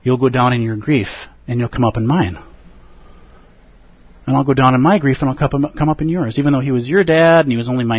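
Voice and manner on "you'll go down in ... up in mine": ordinary delivery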